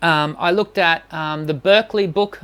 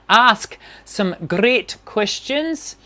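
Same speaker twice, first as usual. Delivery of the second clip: slow, hammed-up bad Irish lilt; mock Irish brogue, played for a laugh; terrible Irish accent; 3 semitones higher, much slower than usual. Shown as a demonstration. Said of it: "That was my terrible Irish accent".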